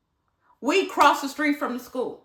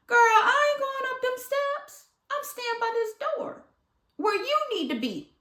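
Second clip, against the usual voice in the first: pitched way up, digging in at the door; scared refusal; 12 semitones higher than usual. Retold or imitated. Refusing to climb the stairs.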